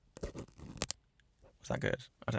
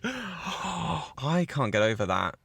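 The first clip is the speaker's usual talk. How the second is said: 1 gasping awe; 6 semitones higher, much slower than usual. Real, not acted. Gasping at her new phone.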